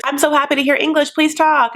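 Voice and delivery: high, rushed mock-delighted plea; eager relief. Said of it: "and she's like".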